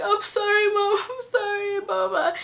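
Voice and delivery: retelling a tearful sorry to her mom, high pleading voice; tearful apology. Retold or imitated.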